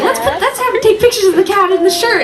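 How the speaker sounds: put-on high eager kid voice; excited kid. Kid wanting pictures of the cat in a shirt. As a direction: chirp like an excited little kid. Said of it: "they'd be like".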